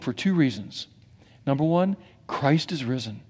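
breathy whisper; hushed confession. Acted. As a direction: lean in and confide it. Intimate declaration.

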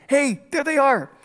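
mock kid shout; excited kid yell. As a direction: call out like a kid spotting the car. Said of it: "one of the kids yells".

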